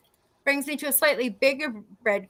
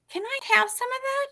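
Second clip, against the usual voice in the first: high, lightly voiced cemetery worker; high-pitched request; 12 semitones higher than usual. Cemetery worker asking for some of the bag's mixture, high-pitched request. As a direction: ask high and tentatively, imitating him.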